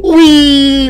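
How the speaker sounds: high, loud whee with laughter; gleeful squeal. Real, not acted. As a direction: squeal with delight as you fly. Gleeful flight.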